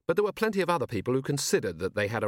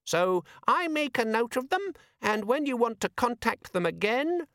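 very high, piping explanation; high-pitched demon pressing on with its pitch; gadget demon, forced enthusiasm; 12 semitones higher than usual. A narrator's reading. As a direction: explain it in a tiny perky imp voice. Demon organiser voiced in story.

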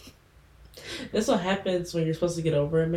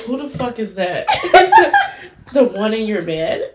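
laughing, loud and high; laughing outburst; 5 semitones higher, 10 dB louder, slower than usual. Retold or imitated. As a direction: blurt it while laughing. Retold shocked question, laughing.

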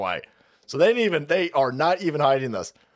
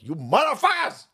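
mock road rage; 5 semitones higher than usual. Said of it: "every time I go through Livermore, like".

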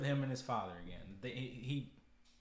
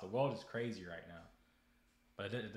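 ordinary talk, nothing marked

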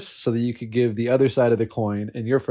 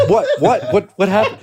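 high, loud, laughing what-what; shocked reaction; 8 semitones higher, 7 dB louder than usual. Retold or imitated. Stunned viewers reacting to the game, high surprise. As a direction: blurt out in disbelief. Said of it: "just reacting like".

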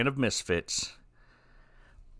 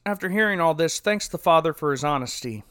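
ordinary talk, nothing marked

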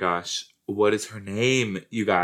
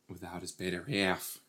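very soft, hushed line; deliberately altered reading; 11 dB quieter than usual. Retold or imitated. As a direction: drop it right down, nearly under the breath. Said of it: "That's not how she said it, but that's how I said it".

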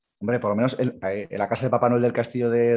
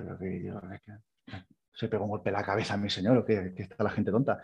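much softer, muttered to himself; puzzled mutter; 3 semitones lower, 8 dB quieter than usual. Retold or imitated. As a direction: grumble under your breath, baffled. Luis puzzled about his master.

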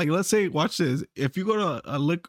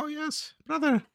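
high, soft mock Indian accent; imitated Indian accent; 10 semitones higher, 3 dB quieter than usual. Customer copies the Indian clerk's greeting, mock Indian accent. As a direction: echo his Indian accent, pitched up and soft. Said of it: "like, how he said something".

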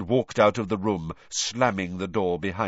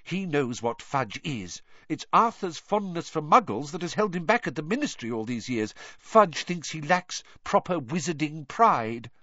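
higher-pitched, indignant mother's voice; earnest mother with a lifted voice; resolute mother; 7 semitones higher than usual. Acted. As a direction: speak up firmly for your husband, pitched high.

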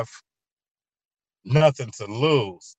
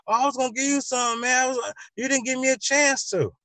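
much higher, defensive excuse; whiny excuse; 11 semitones higher than usual. Acted. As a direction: plead innocence in a high put-on voice. Acted excuse in the quarrel.

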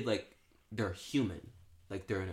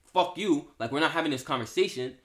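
dismissive angry man; 4 semitones higher, 4 dB louder than usual. Retold or imitated. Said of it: "they're just like".

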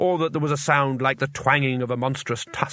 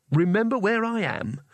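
indignant shout; 8 semitones higher than usual. A narrator's reading. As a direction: throw it back indignantly, pitched up. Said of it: "shouted Rincewind".

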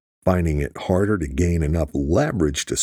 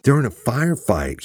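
mild, higher-pitched narrated yell; urgent yell; 7 semitones higher than usual. A narrator's reading. Jack bursting into Rhodes's office with news, urgent yell. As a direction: raise the pitch in urgent alarm. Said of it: "he yelled".

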